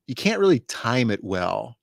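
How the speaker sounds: ordinary talk, nothing marked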